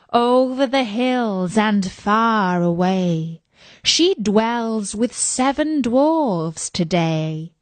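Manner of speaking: slow, solemn oracle chant; mirror's otherworldly slow recitation; magic mirror. Acted. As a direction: intone the verse slowly, like an oracle. Magic mirror answers in verse.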